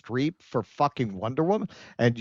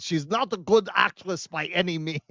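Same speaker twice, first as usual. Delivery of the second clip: put-on Israeli accent, lilting up and down; mock Israeli accent. Host reads out a subreddit comment, mock Israeli accent. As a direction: read it out in a hammed-up Israeli accent. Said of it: "Even the Israel subreddit agrees".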